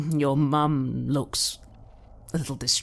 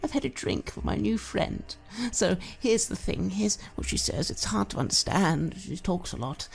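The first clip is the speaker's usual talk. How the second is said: put-on high, wheedling drunk mother with sudden whispers; creepy doting mother; 3 semitones higher than usual. Mother wheedling her grown son Joshy, creepy doting voice. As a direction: coax your son sweetly, menace underneath.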